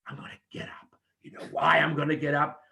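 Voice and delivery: intense whispered threat. Shown as a demonstration. Whispered lines inside promo demo.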